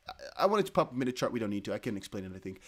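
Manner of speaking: ordinary talk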